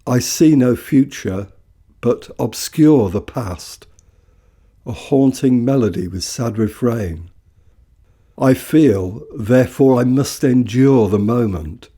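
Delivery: ordinary talk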